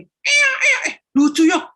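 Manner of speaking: high loud hushing; boy hushing baby. Acted. Philip hushing his crying little brother, high loud child voice. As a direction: hush the crying baby in a panic.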